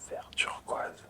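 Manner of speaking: ordinary talk, nothing marked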